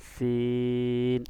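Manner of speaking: long held vowel, three alif stretch; drawn-out sin. Shown as a demonstration. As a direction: hold the vowel long and loud like a Quran teacher. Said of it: "তিন আলিফ টান দিবেন".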